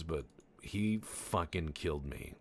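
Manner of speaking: usual speaking voice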